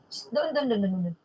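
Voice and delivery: near-whispered shh, pointing someone over there; hushed directions. Real, not acted. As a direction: hush them and send them off quietly. Hushes someone, points them away. (pabulong na pagtuturo ng direksyon)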